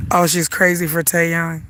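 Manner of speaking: ordinary talk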